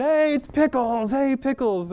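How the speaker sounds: high, slow, loud call to a dog; excited greeting. Retold or imitated. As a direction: call the dog across the trail, thrilled. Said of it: "and Steven".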